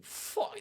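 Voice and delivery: sharp high breathy curse; mock exasperated fuck. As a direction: spit it out in disgust. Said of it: "she needs to be like".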